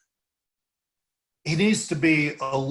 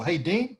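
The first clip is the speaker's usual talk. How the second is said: higher pitch climbing on "Dean"; rising intonation on the name; 6 semitones higher than usual. Shown as a demonstration. Teaching him the cold-call opener, rising intonation. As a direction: say "Dean" with an upward, familiar lift. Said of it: "go up on the name".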